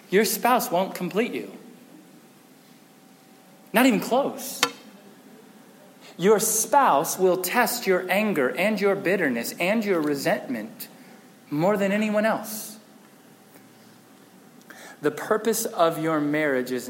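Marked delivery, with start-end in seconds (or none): none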